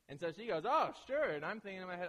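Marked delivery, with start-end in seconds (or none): 0.6-1.3 high, loud, breezy yes; eager agreement. Retold or imitated. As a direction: imitate her chirping up, game for it. Acting girlfriend agreeing to catch fish.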